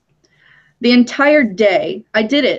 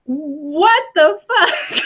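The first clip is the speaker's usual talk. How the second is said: stunned disbelief; 6 semitones higher, much slower than usual. Retold or imitated. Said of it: "And I was just like".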